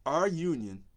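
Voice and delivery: ordinary talk, nothing marked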